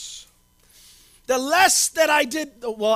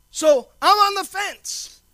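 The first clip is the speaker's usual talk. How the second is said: slow and louder, so I'm ON the fence; slow drawn confession; 6 semitones higher, 7 dB louder, slower than usual. Real, not acted. Pastor to church about Marvel movie, slow emphatic. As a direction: draw it out, weighing each word.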